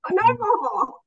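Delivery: high, laughing refusal; real defiant laughter. Real, not acted. Student done living in the lie, laughing defiantly. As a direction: throw it off with a high, freeing laugh.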